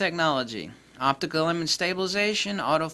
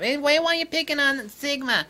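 mock Sigma fan; 10 semitones higher than usual. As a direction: whine like a hurt Sigma owner.

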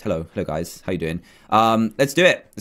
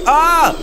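startled scream; 10 semitones higher, 5 dB louder than usual. Real, not acted. Startled by a monster.

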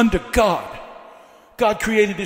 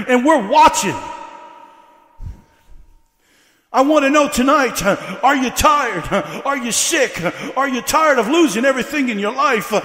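full-force preaching; 4 semitones higher than usual. Real, not acted. Preacher rousing a church tired of the devil, full-force preaching. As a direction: fire up the congregation, phrase by punched phrase.

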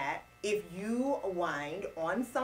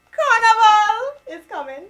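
excited; 13 semitones higher, 16 dB louder, slower than usual. Real, not acted. Excited announcement of Carnival.

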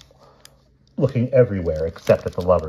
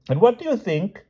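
very high, eager question; excited dotty king. A narrator's reading. King carries on his tale, high and excited. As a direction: bubble over with news.

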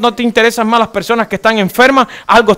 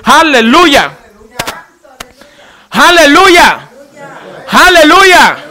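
loud hallelujah cries, building higher; shouted praise; 6 semitones higher, 5 dB louder, much slower than usual. Real, not acted. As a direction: call out praise to the whole church. Preacher calls out praise to congregation. (aleluya a viva voz)

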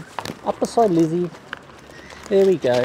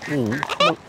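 normal talking voice

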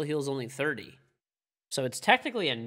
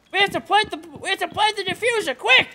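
loud, high, urgent gamer shout; excited shout; 8 dB louder than usual. Real, not acted. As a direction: yell it out in panic. Round ending unexpectedly in match.

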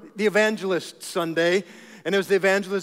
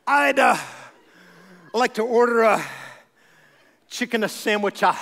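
mock evangelist ordering; 3 semitones higher than usual. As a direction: order fast food like a showy evangelist. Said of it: "go to Chick-fil-A and do that".

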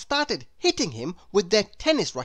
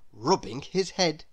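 ordinary delivery, nothing marked

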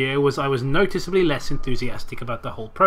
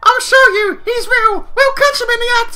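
put-on very high, loud excited kid; hammed-up pleading child; little boy voice; 10 dB louder than usual. A narrator's reading. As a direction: squeak it like an eager little brother. Voices little brother in story.

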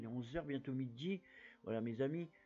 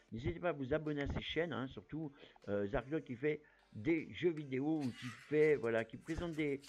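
ordinary delivery

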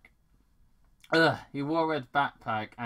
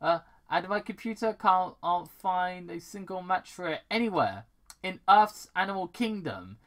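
ordinary delivery, nothing marked

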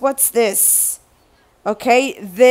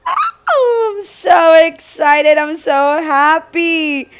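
higher, excited cry of joy; thrilled; 5 semitones higher than usual. Real, not acted. Sharing the box with viewers, high thrilled cry. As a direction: burst out with happiness. Delighted at stickers.

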